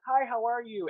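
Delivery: high-pitched receptionist hello; imitated chirpy greeting. Salon receptionist greeting a job seeker, chirpy imitation.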